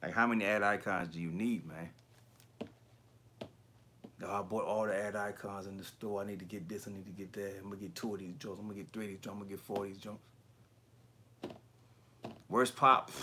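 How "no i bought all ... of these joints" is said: soft mumbling impression. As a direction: mumble softly, mimicking a quiet collector. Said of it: "he do talk soft as hell".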